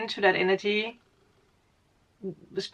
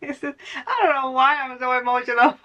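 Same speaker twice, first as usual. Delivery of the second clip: higher, playful put-on voice of someone lost; mock clueless viewer; 5 semitones higher than usual. Reader mimicking a confused viewer, pitched-up mock voice. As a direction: play the puzzled viewer, pitched up and teasing.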